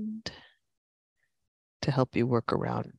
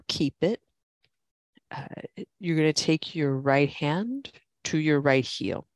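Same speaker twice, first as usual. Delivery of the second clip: normal talking voice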